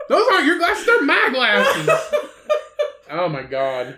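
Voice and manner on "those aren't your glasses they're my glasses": high, loud mock protest, slowing at the end; hammed-up squabbler, cracking up; retold bickering. Acting out the glasses squabble, laughing.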